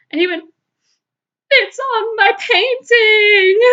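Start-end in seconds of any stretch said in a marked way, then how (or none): 1.5-3.6 put-on much higher, louder, slow child's wail; wailing little boy. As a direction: drag it out like a sobbing five-year-old. Crying boy voiced in story.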